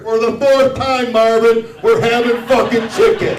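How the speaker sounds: high, loud furious shout; wife screaming at her husband about the chicken. Retold or imitated. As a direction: explode at him, fed up. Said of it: "wife's exploding answer".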